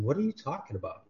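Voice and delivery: normal talking voice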